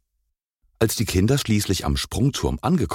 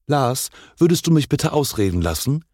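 higher-pitched teacherly reproof; admonishing; 7 semitones higher, 3 dB louder than usual. A narrator's reading. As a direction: mildly reprimand an interrupting pupil. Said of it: "mahnte ihn Frau Klein".

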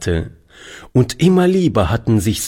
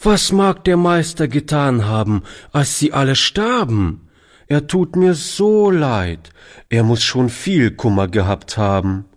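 put-on higher, pitying child's voice; sympathetic little boy; 6 semitones higher than usual. A narrator's reading. Boy Toni pitying the Master, high childlike sympathy. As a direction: feel sorry for the Master like a child.

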